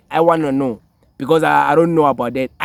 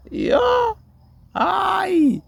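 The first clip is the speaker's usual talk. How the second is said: laugh-crying squeal. Real, not acted. Laughing to tears.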